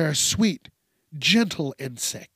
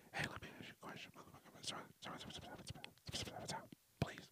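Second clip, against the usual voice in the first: fast, breathy creepy whisper; 28 dB quieter, much faster than usual. Shown as a demonstration. Showing how creepy constant whispering sounds. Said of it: "could you imagine whispering".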